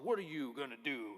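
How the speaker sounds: lightly voiced tough guy, chest out; mild tough-guy challenge; tough guy taunt. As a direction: taunt them like a bully flexing. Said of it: "he's flexing his muscles".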